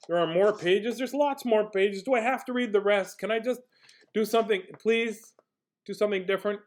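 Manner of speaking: high, whiny-comic begging; mock pleading. Reader begs to skip remaining pages, high mock pleading. Begs not to read rest.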